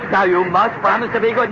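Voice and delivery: ordinary delivery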